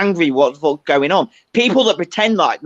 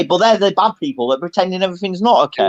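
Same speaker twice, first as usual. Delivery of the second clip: usual speaking voice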